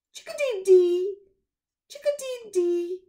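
loud, high chirping call; chickadee chirp. Acted. Narrator chirping the chickadee's song, loud and high. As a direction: chirp it like a little bird. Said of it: "The chickadee chirps".

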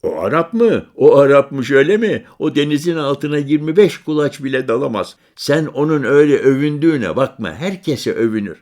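put-on high, loud bragging boy; boastful child. Boy boasting to the stranger about his father, high and loud. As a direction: brag about your father like a kid.

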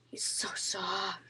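slow, very soft near-whisper; tender hush. Real, not acted. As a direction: whisper it slowly while petting. Showing the bird tenderly.

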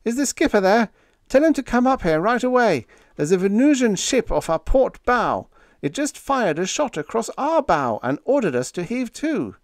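high, urgent alarm; panicky yelling. A narrator's reading. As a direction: report trouble in a panic. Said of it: "he yelled".

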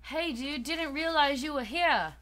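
friendly greeting, accidentally British; British-sounding accent. Acted. Bandmate greeting a fan they didn't expect, British-sounding accent. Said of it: "Why are they British?".

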